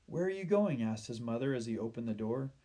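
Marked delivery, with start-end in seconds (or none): 0.1-0.8 high, raised questioning call; mother's question. A narrator's reading. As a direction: call after the boy. Mother calls to departing son.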